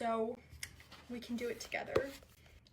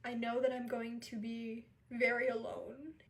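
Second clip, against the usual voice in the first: normal talking voice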